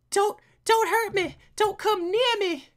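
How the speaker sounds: high, loud pleading woman voice; frightened woman. Acted. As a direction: beg him high and scared.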